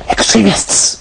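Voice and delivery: whispered echo of the word; spooky whisper. Acted. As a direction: breathe it like a ghostly echo.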